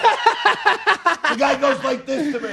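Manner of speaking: high, slow words squeezed through laughter; laughing hard. Real, not acted. Friend retelling the tattoo story, cracking up.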